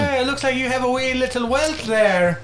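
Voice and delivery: high, hammed-up Irish lilt; mock Irish cop. Cop to hurt kid, mock Irish accent. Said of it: "they all had Irish accents".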